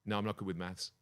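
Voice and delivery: ordinary delivery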